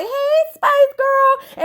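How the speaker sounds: high, sing-song greeting voice; mock greeting. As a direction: squeal a fake-friendly hello. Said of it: "everybody like".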